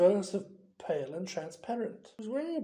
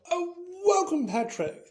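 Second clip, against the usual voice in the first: strained welcome; 11 semitones higher, 7 dB louder than usual. Acted. Nick greeting stiffly.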